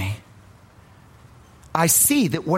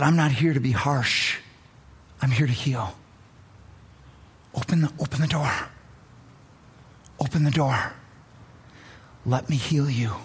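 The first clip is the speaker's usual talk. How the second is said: tender invitation, letting each line sink; gentle Jesus; 5 semitones lower than usual. Retold or imitated. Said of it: "came in gentleness".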